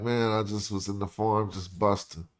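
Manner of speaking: low, soft and casual, shrugging it off; laid-back farmer. Retold or imitated. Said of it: "He'd be like".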